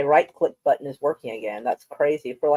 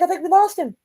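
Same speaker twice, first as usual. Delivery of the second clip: much higher playful quote of herself; mock dramatic voice; 11 semitones higher, 4 dB louder than usual. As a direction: replay the line in a high put-on voice. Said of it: "I was like".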